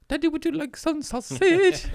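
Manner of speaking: squeaky high falsetto, far from his own voice; a silly high-pitched kid voice. Pretend. Joking to his buddies in a squeaky high falsetto. Sudden falsetto joke line.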